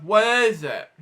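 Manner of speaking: plain reading voice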